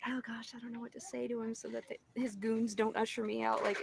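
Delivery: ordinary delivery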